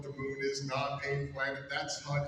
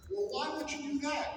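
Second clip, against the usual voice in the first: much higher, incredulous question; mock disbelief; 12 semitones higher than usual. Broadcaster asks teammate why he stole it, high mock disbelief. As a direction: re-enact your baffled protest in a high voice. Acts out his shocked reply.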